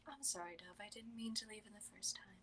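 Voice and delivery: hushed tender whisper; soft whisper to a small creature; soft whispered apology. Acted.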